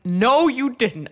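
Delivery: loud, high, drawn-out denial; acted disbelief. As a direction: belt it out in retold disbelief. Acting her disbelieving reaction.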